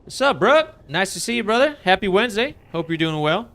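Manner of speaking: loud, high, pumped-up hello; hyped stream greeting. Real, not acted. Streamer welcoming a chatter to the stream, loud and pumped. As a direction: greet him like a long-lost buddy.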